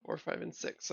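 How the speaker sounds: half-whispered, repeating under breath. Real, not acted. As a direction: murmur it back to yourself. Host repeats numbers to self.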